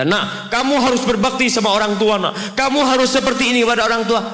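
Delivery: put-on high, loud preachy parent voice to a child; mock-scold the child like a nagging parent; lecturing parent. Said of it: "bukan kita ngasih tahu sama anak kita".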